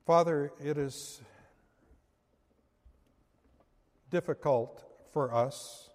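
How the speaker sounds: soft, hushed closing prayer. Acted. Preacher praying to the Father for his congregation, softly. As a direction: pray gently, drawing the listeners in.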